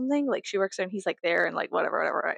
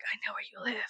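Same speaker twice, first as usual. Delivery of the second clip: creepy whisper; 9 dB quieter, much faster than usual. Retold or imitated. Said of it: "some guy was like".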